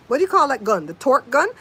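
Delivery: ordinary talk, nothing marked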